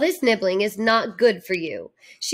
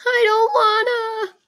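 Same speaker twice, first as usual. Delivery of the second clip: much higher, tearful wail; crying refusal; 11 semitones higher than usual. Acted. Lardy refusing the bath again, crying higher. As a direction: cry it out, begging not to go.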